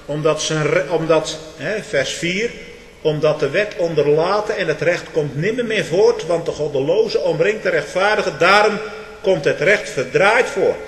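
building from firm to a loud, high cry; fiery preaching. Acted. (vurig preken, steeds luider)

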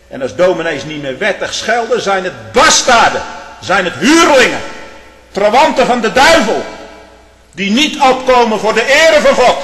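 loud, high fire-and-brimstone preaching; preaching at full force. Acted. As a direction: thunder it from the pulpit. Preacher condemns false ministers.

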